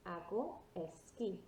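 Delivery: slow model "Hago esquí" with no H sound; silent H. Shown as a demonstration. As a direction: say "Hago esquí" slowly, "Hago" starting on the A. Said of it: "your H is always silent".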